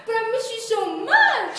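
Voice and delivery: high, swooping lovesick whine. Acted. Lover who must work tomorrow, mock lovesick swooping voice. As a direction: swoop up loudly on the longing, hammed-up. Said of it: "your voice goes up and down".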